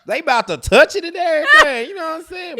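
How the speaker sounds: loud and high, laughing through it; cracking up while hyping the car; laughing excitedly. Real, not acted. Stepdad laughing about the car, loud and high.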